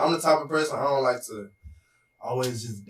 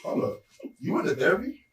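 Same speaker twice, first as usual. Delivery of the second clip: startled, rising disbelieving question; surprised; 7 semitones higher than usual. Real, not acted. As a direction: catch it in sudden disbelief. Shocked by sibling's therapy news.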